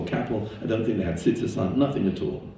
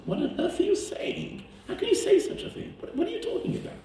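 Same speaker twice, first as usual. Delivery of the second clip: very high, softer voice of disbelief; incredulous protest; 4 dB quieter than usual. Retold or imitated. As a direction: retell your stunned objection, pitch right up. Stunned reply to a neighbor's snub.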